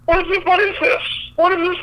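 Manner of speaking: high, repeated baffled questions from a dad; bewildered dad. Retold or imitated. Dad reacting to a TV commercial, high and baffled. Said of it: "My dad saw the commercial, like".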